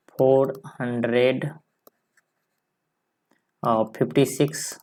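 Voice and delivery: slow, drawn out four hundred; teacher dictating four hundred slowly; slow reading. Shown as a demonstration. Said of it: "उसके बाद कितना है".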